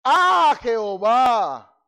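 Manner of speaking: loud, drawn-out cry to God; furious outcry, stretched slow; angry prayer. Acted. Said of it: "se enojó y oró a Jehová".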